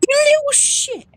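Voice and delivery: sudden high yell of shock; shrieking in surprise at the screen; startled yell. Real, not acted. Startled by the baby in game.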